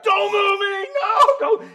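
high loud pained pleading; panicked cry. Retold or imitated. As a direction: cry out in pain not to be moved. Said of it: "People were, like, coming to grab".

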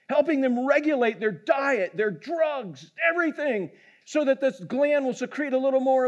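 high, insistent imitation of a doctor; retold worked-up doctor. Doctor pressing the guide about glands, mock-exasperated.

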